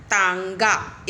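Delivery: तांगा drawn out slowly and loudly; slow nasal demo. Shown as a demonstration. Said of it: "उस स्वर में उच्चारित करते हैं". (तांगा धीरे और ज़ोर से, नाक वाला आं)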